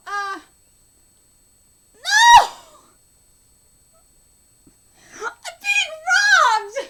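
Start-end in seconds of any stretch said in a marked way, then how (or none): 2.0-6.8 high, shouted protest, drawn out; outraged wail. Real, not acted. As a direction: howl that you've been cheated. Outraged at the story's turn.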